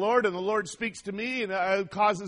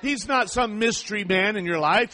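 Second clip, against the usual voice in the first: ordinary delivery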